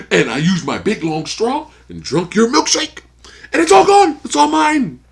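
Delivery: high gloating that climbs to a loud shout; over-the-top film monologue impression; hammed-up gloating villain. Impersonating Daniel's milkshake speech.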